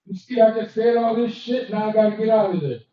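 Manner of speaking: normal talking voice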